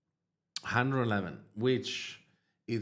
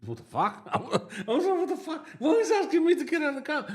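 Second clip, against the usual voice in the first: shocked disbelief; 6 dB louder than usual. Retold or imitated. Shocked thought at the stop.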